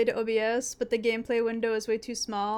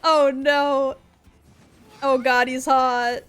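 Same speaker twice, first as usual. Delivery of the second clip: mock swooning over a hot villain, raised voice; flustered swoon; 2 semitones higher, 5 dB louder, slower than usual. Streamer reenacting her reaction to the hot villain, flustered. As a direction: gasp it out like a smitten fan. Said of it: "I was like".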